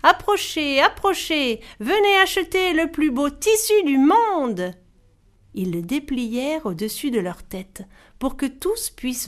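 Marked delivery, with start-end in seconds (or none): 0.0-0.8 loud rising hawker's call; market call. Acted. Brothers calling buyers at the market, loud call. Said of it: "les sept frères crièrent". 0.8-1.6 repeated market call. Acted. Brothers calling buyers at the market, repeated call. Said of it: "les sept frères crièrent". 1.8-4.7 loud, high hawker's shout; market cry. Acted. Brothers hawking cloth to villagers, loud cry. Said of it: "les sept frères crièrent".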